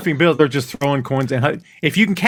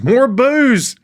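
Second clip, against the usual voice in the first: high, swooping party shout; mock yell; 11 semitones higher, 4 dB louder than usual. Fan retelling a team Christmas party, high mock yell. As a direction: call it out high, like a heckler in the room. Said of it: "someone yells".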